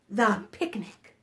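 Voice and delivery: ordinary delivery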